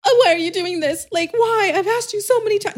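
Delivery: very high, whiny girlfriend imitation; mock wounded pleading. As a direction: wail it like a wronged girlfriend, hammed-up. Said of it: "This is how the girls that he's cheating on have texted him".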